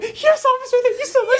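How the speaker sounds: high, loud, overly polite voice; mock polite teens. Teens thanking the Boston cop, high nervous politeness. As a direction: squeak out a nervous thank-you.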